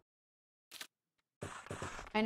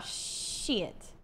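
usual speaking voice

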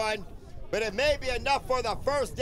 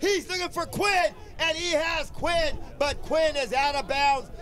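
raised pitch, rising commentary excitement; excited play-by-play; 6 semitones higher, 4 dB louder than usual. Real, not acted. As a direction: call the pass live and urgent. Live pass play call.

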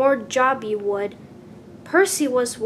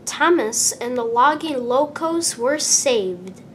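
ordinary delivery, nothing marked

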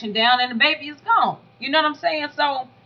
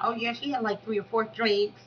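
mocking Ashley; 10 dB quieter than usual. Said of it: "Ashley was running around talking about".

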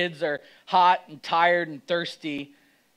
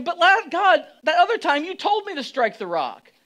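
protesting Moses; 9 semitones higher, 6 dB louder than usual. Retold or imitated. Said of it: "picture Moses going".

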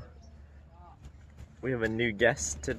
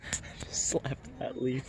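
whispered giggle; 2 semitones higher, 8 dB quieter, slower than usual. Real, not acted. Whispering to the camera after slapping a leaf. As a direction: hush it out, still giggling.

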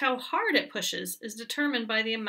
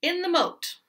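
higher, louder-starting falling pronouncement; 7 semitones higher, 3 dB louder than usual. A narrator's reading. Goat announcing where it is, pronounced. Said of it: "pronounced the goat".

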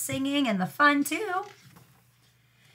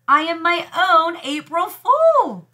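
higher, slower, hammed-up lament; mock despair; 7 semitones higher, 5 dB louder, slower than usual. A narrator's reading. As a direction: wail over tricking herself. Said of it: "This is the worst April ever".

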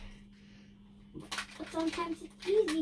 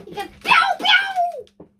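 high, loud pew pew zaps; blaster sound effect; 11 dB louder than usual. Pretend. Kid showing off his toy blaster. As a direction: fire a toy laser gun with your voice. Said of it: "Because it's funny".

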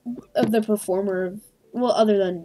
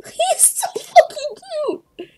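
very high, slow laughing squeal; laugh-cry it out, overwhelmed by cuteness; 5 dB louder, much slower than usual. Real, not acted. Melting over the puppet's reply, squeaking through laughter.

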